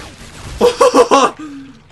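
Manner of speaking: high, loud nervous cackle of relief; shrieking laugh. Real, not acted. Gamer laughing loudly after a jump scare. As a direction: laugh hard right after the fright.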